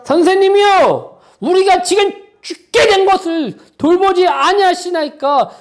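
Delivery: disciples crying out, high and loud; desperate shout. Acted. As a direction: hurl the plea up like men about to drown. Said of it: "제자들의 이 말이 좋은 말로 들리십니까?". (죽을 것 같은 제자들의 절박한 외침)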